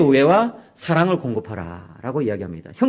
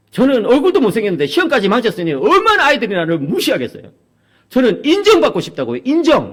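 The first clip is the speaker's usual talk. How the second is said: higher, louder pleading whine; a son insisting he wants to be acknowledged; pleading teen son; 7 semitones higher, 4 dB louder than usual. Retold or imitated. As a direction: beg for recognition like a teenager.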